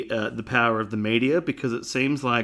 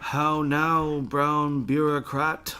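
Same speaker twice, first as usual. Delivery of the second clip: slow character voice; 2 semitones higher, much slower than usual. Retold or imitated. Quoting the character slowly.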